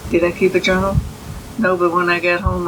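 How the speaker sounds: usual speaking voice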